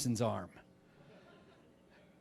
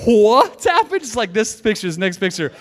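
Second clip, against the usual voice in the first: high, loud stunned question; shocked disbelief; 5 semitones higher, 10 dB louder than usual. Retold or imitated. Classmates floored by the story, high and loud. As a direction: blurt it out amazed. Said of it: "we were like".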